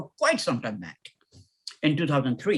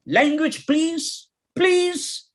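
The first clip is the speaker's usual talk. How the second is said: very high-pitched, insistent admonishment; plead-scold in a high schoolmaster's voice; scolding headmaster; 9 semitones higher, much slower than usual. Acted. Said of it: "screams our English headmaster, our guardian".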